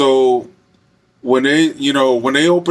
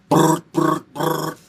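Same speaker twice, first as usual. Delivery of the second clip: raised rattling brr noises; imitated machine gun burst; machine gun sound; 4 semitones higher, much slower than usual. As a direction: rattle off gunfire with the lips. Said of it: "like a machine gun sound".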